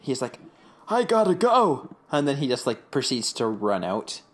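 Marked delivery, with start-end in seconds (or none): 0.9-2.0 high, loud, drawn-out "gotta go"; hammed-up hurried getaway; urgent exit line. Man bolting from Whit and Eugene, mock urgent cry.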